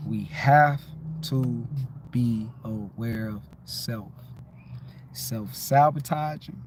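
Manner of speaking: slow, low, deliberate words; slow emphatic teaching. Shown as a demonstration. Teaching viewers to be aware of self, slow. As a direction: spell it out slowly for them. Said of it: "y'all gotta say it".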